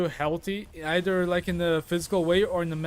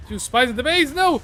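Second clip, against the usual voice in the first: alarmed call-out; 7 semitones higher, 8 dB louder than usual. Real, not acted. Alarm during game.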